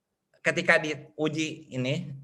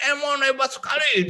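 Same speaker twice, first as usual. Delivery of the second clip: mock shout; 9 semitones higher than usual. Pupil Emon finally releasing his emotions in class, mock shouting. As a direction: cry it out like a pupil letting go. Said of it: "Akhirnya dia teriak".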